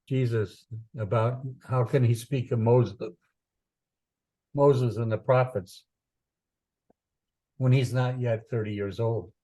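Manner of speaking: ordinary talk, nothing marked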